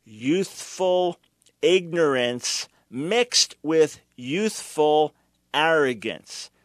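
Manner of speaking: each word of Youthful ignorance mixed with youthful arrogance spaced out slowly; slow emphasis. Shown as a demonstration. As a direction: spell it out slowly for the audience. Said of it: "Shall I repeat it?".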